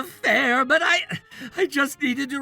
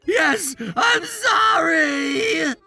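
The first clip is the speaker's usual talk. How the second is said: wailed, drawn-out apology; terrified apology; 8 semitones higher, 9 dB louder, much slower than usual. Acted. Son apologizing to his menacing mother, terrified. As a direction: cry it out, tearful and cowed.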